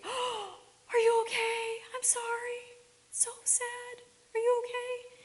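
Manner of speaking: high, slow worried coo; anxious fussing parent. Retold or imitated. Parent fretting over a toddler who fell, high and anxious. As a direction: fuss over the fallen child in alarm.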